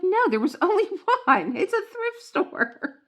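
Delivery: high, loud laughing protest; laughing exasperation. Retold or imitated. Wife telling husband the thrift store mug was one of a kind, laughing and high. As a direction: squeal it through a laugh. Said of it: "I'm like".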